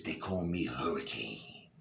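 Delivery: low, slow, soft come-on; sultry Hurricane. Acted. Hooker purring her name to the nervous guy. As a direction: purr it like a seductress.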